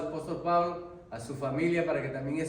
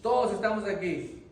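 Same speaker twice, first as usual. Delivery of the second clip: loud, raised call; 6 semitones higher, 5 dB louder than usual. Retold or imitated. Paul calls to the jailer that all are there, loud call. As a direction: shout the reassurance across the cell. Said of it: "le dice".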